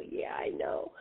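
breathless awed whisper; whispering to Dorothy, the writer she admires, starstruck. Retold or imitated. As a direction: whisper it, overwhelmed by meeting a hero. Starstruck reply in retold meeting.

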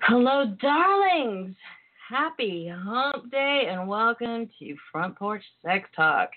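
slow breathy purring greeting; breathy vintage purr. Acted. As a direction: purr it like an old-time siren. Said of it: "earlier reader: breathy vintage purr".

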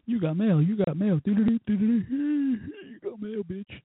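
high sing-song you-got-mail chime, then a dropped bitch; phone alert noise. Retold or imitated. Phone pinging with messages, high chiming imitation. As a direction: chant the alert like the phone does.

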